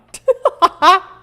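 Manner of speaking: high burst of laughter; loud laugh. Real, not acted. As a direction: break into loud giggles. Laughs at camera trouble.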